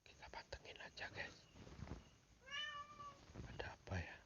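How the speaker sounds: soft voiceless whisper. Real, not acted. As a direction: whisper while listening closely. Listening for noises at night.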